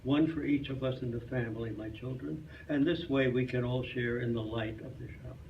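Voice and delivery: ordinary delivery, nothing marked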